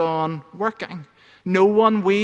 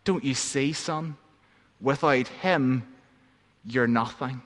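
soft, lower, tender words; gentle mother; 4 semitones lower, 4 dB quieter than usual. Acted. Mother gently comforts her sobbing son, softer and lower. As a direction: comfort him like a loving mother. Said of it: "she kisses him gently on the forehead".

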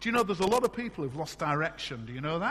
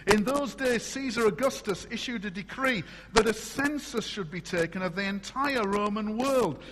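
louder, measured reading from the Bible; scripture reading; 5 semitones higher, 7 dB louder than usual. Acted. Preacher reading the nativity story aloud to the congregation. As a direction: proclaim the verses to the church.

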